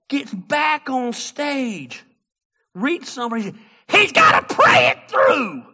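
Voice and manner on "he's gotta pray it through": much higher, loud, drawn-out preacher shout; preacher fervor. Retold or imitated. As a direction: preach it like a revival call.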